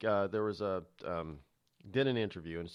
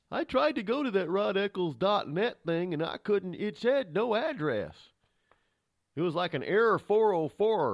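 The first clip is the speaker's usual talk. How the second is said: mock confused caller, higher and louder; 10 semitones higher, 5 dB louder than usual. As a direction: play a baffled listener complaining it didn't work. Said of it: "some people will just write the word dot".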